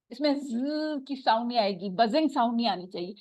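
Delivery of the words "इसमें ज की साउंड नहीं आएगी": normal talking voice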